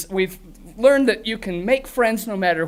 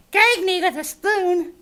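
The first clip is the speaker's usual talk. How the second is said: put-on puppet valley-girl line; 10 semitones higher, slower than usual. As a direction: drag it out for the laugh. Grork's old joke.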